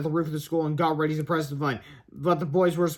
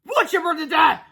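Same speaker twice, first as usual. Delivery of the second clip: high wailing alarm; hammed-up story-character panic; panicked cry; 10 semitones higher, 4 dB louder than usual. A narrator's reading. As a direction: yell as doom approaches. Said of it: "cried Fluffy".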